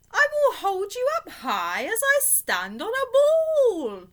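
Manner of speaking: a cat showing off his balance; boastful cat. Acted. Cat in the Hat voice.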